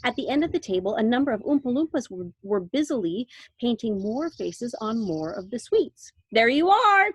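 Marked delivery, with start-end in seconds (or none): 6.3-7.1 high, loud triumphant cry; cried out. A narrator's reading. Mister Wonka crying out in delight. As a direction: announce it with delight. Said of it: "cried Mister Wonka".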